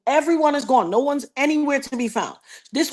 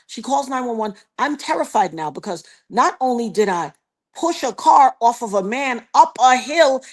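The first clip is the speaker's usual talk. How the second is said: normal talking voice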